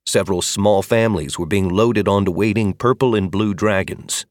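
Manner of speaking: ordinary delivery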